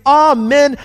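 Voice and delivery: slow, high, soaring amen and alleluia; drawn-out cry of worship; worshipful acclaim. A narrator's reading. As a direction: stretch each word out in exultant praise. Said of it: "fell down and worshiped".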